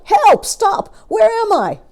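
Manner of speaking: dazed crocodile tumbling, frantic cries; panicked cries. Acted. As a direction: yelp for help while spinning.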